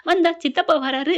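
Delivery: ordinary delivery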